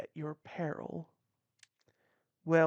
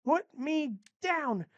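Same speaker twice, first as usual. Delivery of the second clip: put-on high little girl; 10 semitones higher than usual. Kidnapped girl protests.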